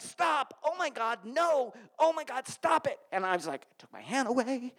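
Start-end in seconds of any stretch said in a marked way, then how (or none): 0.0-3.0 higher, panicky mock girl voice; mock alarmed cries of stop; alarmed girlfriend. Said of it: "She's like".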